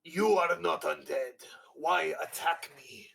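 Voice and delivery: put-on slow, loud Russian-accented Viking; heavy Russian accent, each word slow and loud. Crazed Viking confronting the player in a slow, loud Russian accent. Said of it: "we're gonna go with a Russian, Russian accent".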